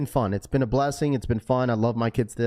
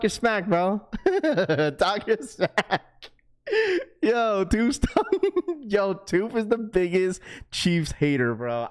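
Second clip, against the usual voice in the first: laughing while talking; 8 semitones higher, slower than usual. Real, not acted. Laughing at a viewer's trash talk.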